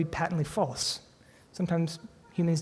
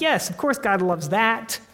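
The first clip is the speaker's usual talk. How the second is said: slow, higher, self-satisfied "Yes, of course"; mock smug agreement; 5 semitones higher, 4 dB louder than usual. Pastor mocking the congregation's easy assumption, in a slow, higher, smug voice. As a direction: parody complacent churchgoers nodding along. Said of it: "and we're like".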